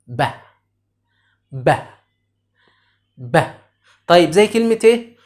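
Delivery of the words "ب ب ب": "ب" as a short isolated B sound, "buh"; letter sound demo. Shown as a demonstration. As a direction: sound out "ب" briefly, not the letter name. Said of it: "طيب، نطقه إيه؟". (نطق "ب" كصوت حرف B منفردًا وقصيرًا)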